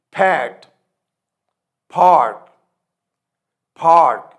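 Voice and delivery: پھاٹ said loud with a strong aspirated ph; drilled loud word. Shown as a demonstration. Teacher drilling aspirated p for the class. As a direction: punch each word loud with a puff. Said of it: "it will become پھیٹ".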